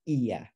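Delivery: "iya" begun afresh on its own i vowel; "iya" with its first vowel sounded separately; independent opening vowel. Shown as a demonstration. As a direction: start "iya" cleanly, unjoined to the word before. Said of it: "as an independent vowel".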